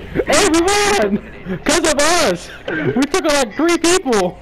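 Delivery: high, hyped yelling; excited shout. Real, not acted. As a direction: yell it out, thrilled. Hyped after the play.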